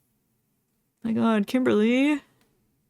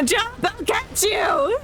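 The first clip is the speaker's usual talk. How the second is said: calling out; 11 semitones higher, slower than usual. Acted. Calling to character.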